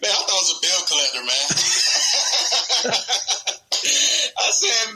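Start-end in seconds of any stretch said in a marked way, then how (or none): 1.5-4.3 long high burst of laughter; real amused laughter. Real, not acted. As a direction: laugh hard at yourself. Laughs at his own mistake.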